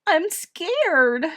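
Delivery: put-on high, frightened child's plaint; acted tearful little girl. A narrator's reading. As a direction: confess fear as if sobbing. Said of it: "Edith sobbed".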